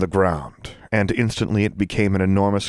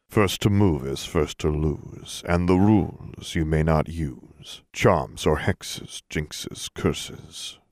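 slow, lower chanted verse; low rhythmic cadence; 3 semitones lower, 3 dB quieter, slower than usual. A narrator's reading. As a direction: intone it like an incantation. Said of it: "spoke in a low, rhythmic cadence".

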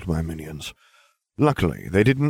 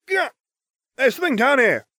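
much higher yell from below. A narrator's reading. Ron yells up from the hatch, yelling. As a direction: call up from the pit. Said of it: "he yelled".

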